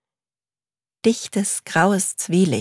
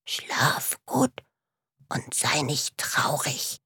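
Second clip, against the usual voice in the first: voiceless whisper with a catch of crying; whispered, near tears; 3 semitones lower, 8 dB quieter, slower than usual. Acted. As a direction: whisper the letter to himself, forlorn. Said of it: "flüsterte Mumin". (leise geflüstert, den Tränen nah)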